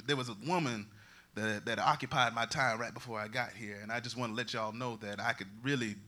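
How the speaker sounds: usual speaking voice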